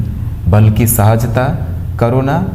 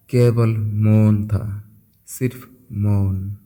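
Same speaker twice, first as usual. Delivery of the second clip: soft, unhurried voice with long pauses; guided meditation; 7 dB quieter than usual. Acted. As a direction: guide listeners gently into stillness, phrase by phrase. (शांत, धीमे स्वर में ध्यान कराते हुए)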